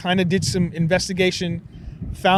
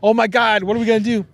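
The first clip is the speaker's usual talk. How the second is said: imitating a panicked crowd, louder; mock panic; 5 semitones higher, 5 dB louder, faster than usual. Imitating frightened people after the alien attack, mock panic. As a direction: ham up the frantic crowd.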